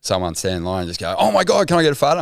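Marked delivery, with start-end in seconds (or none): 1.2-2.2 high, fast mock gushing; excited fan. Fan in line asking the surfer for a photo, mock excited. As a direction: imitate a starstruck fan blurting it out.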